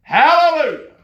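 high, loud cry of praise; exultant shout. Real, not acted. Preacher shouting praise to the congregation, exultant and loud. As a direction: shout it out, overjoyed.

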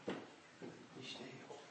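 whispered, stern hush; whispered "be still". Retold or imitated. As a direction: shush them under your breath. Grandpa's stern hush echoed.

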